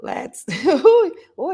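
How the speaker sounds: bright burst of laughter; surprised laugh. Real, not acted. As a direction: laugh in surprise at hearing yourself. Host laughs at own voice.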